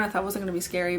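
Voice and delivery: ordinary delivery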